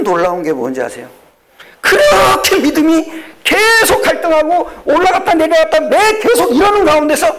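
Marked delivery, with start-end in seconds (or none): 1.8-7.3 high, loud preaching cry; preaching at full force. Acted. Pastor to congregation, crying out in full preaching force. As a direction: lift the congregation with a ringing cry. Preacher shouting his climax.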